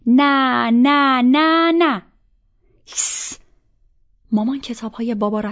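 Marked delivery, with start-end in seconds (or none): 0.1-2.0 loud, high chanted "no", climbing in pitch; children's defiant shout. A narrator's reading. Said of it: "رامین با من داد زد". 2.9-3.4 unvoiced shushing to silence children; sharp hush. A narrator's reading. Mother hushing shouting children.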